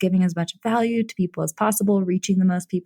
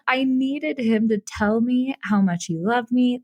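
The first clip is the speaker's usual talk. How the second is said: usual speaking voice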